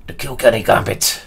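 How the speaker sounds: announcing the opening in a poor fake Irish accent; hammed-up Irish lilt on the name; bad Irish accent. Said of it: "Very bad Irish accent there".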